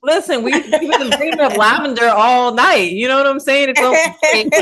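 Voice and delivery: laughing through the words; high, loud, giggly talk. Real, not acted. Laughing while greeting friends.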